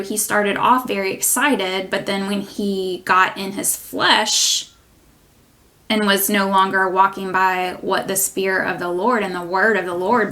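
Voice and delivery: ordinary talk, nothing marked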